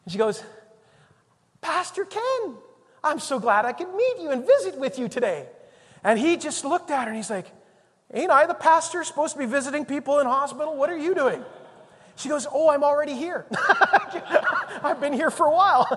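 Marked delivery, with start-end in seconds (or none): 1.6-5.5 high, gushing imitation of a visitor; grinning delight. Churchwoman visiting her pastor in hospital, mock-delighted greeting. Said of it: "she's got this big grin on her face". 8.1-11.4 higher, louder retold protest; mock indignant surprise. Pastor to his visitor in hospital, mock indignant surprise. 12.5-15.9 high, loud, laughing imitation; laughing cheery reply. Churchwoman already a patient herself, laughing cheery reply.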